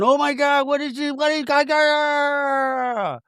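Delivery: high, slow, drawn-out mock fright; mock panic. Meredith fleeing the woods, hammed-up fright. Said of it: "Meredith is going".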